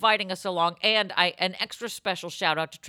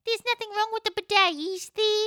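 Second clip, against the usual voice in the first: high, fast, hammed-up Australian accent. Doing an Australian accent to win over a driver. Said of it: "how do I get into my Aussie accent".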